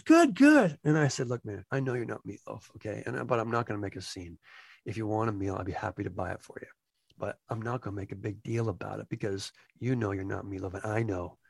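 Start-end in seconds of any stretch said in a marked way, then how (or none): 0.0-0.8 high, eager put-on voice; impostor's cheery reply. Lookalike answering staff's greeting, high and eager. 1.3-11.4 low, hushed, man-to-man talk; discreet confrontation. Retold or imitated. Employee privately confronting a lookalike, low and quiet.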